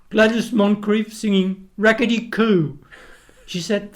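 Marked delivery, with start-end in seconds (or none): 0.1-2.8 mock sulky Frank. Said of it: "And he said".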